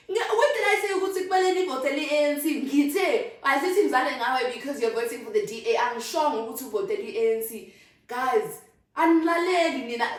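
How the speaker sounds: high, loud exasperation; loud, high frustrated rant; exasperated rant. Real, not acted. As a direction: vent at people who won't listen.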